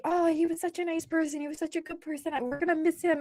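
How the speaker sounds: high, soft mourner voice; imitated sweet condolences; mock eulogizing. Mimics mourners' polite praise.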